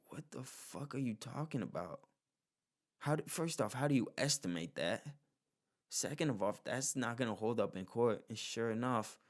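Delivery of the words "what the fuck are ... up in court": higher, mocking disbelief; incredulous jury. Jurors react to the old man's defense with higher, mocking disbelief. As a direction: ask it like it's the dumbest thing you've heard.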